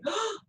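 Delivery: sharp high gasp; shocked gasp. Acted. Dhani gasping at how far Gandhiji will walk. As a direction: gasp at the distance.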